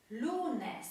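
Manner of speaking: Lunes with a prominent first syllable; penultimate stress. Shown as a demonstration. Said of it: "akcent właśnie pada na".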